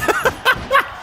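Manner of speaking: high, bursting cackle of amusement; amused laugh. Real, not acted. Player watching opponent run away, high amused laugh.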